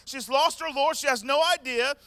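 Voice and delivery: ordinary talk, nothing marked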